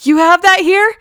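high, loud, excited "You have that here?" rising; mock Farva. Retelling Farva ordering cola, loud high excited mock voice. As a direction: squeal it like a greedy, overexcited customer.

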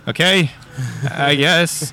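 hesitant, mildly confused reply. Acted. Dad answers a giant sphinx, confused. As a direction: answer with puzzled pauses, unsure. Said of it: "who is confused".

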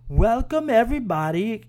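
high, loud Borat-style woman's welcome; Borat-like accent. Retold or imitated. Trivia host welcoming the crowd, in a hammed-up Borat accent. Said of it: "I'm gonna do a horrible impression".

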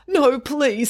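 high, anxious plea; pleading. Acted. Shopkeeper to bank robber, pleading. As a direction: beg the robber to stop.

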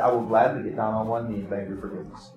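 calm, humble answer to an angry accuser. Retold or imitated. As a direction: answer the anger with quiet calm. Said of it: "very calmly".

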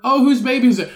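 high, loud mock photographer voice; a photographer playing along sweetly. Photographer asking the surrogate mother, loud and high. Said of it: "can you imagine being the photographer".

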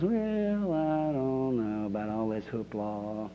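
slow, low, soft doubtful drawl; drawn-out mock reluctance; reserved skeptic. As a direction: play a stuffy, unimpressed churchgoer, stretching the vowels. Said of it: "there'll always be one that goes".

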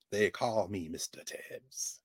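slow, soft mock Poitier accent; imitating Poitier's Caribbean-British accent. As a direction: lightly voice Poitier's island accent, slow and quiet. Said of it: "he always had that accent".

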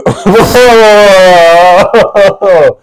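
high burst of laughter; loud laugh. Real, not acted. Guest bursts out laughing while stumbling over words. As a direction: break up laughing. Guest breaks down mid-sentence.

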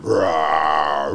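low mock roar, a short playful growl; imitated dinosaur roar. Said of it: "she used to roar at me".

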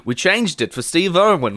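ordinary talk, nothing marked

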